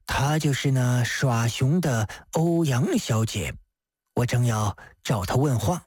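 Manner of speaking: low, composed magistrate voice; sober, low-pitched official; Di Renjie. Acted. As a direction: state it low and purposefully.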